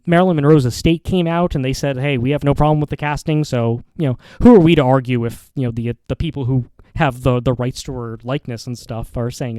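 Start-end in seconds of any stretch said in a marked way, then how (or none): none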